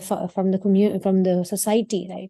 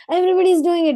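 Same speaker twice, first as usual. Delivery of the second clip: put-on much higher and louder, a kid whining an excuse; mock childish excuse; 10 semitones higher, 6 dB louder, much faster than usual. Child excusing himself to the elders, high mock whine. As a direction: whine it like a child begging to be allowed. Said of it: "we have the tendency to say".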